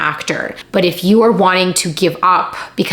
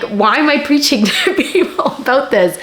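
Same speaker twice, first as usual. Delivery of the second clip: self-mocking laugh; 8 semitones higher than usual. Real, not acted. Laughs at her own preaching.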